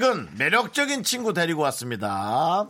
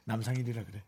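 ordinary talk, nothing marked

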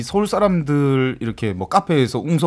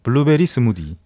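블루베리 스무디 with a strong fall after a punched 블루베리; 블루베리 punched, Busan melody; Busan-accented version; 7 semitones lower than usual. Shown as a demonstration. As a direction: hit 블루베리 hard Busan-style. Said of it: "아, 그렇게 해야 돼".

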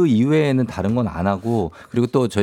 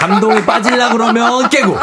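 high, loud laughing complaint; 10 semitones higher, 4 dB louder than usual. Real, not acted.

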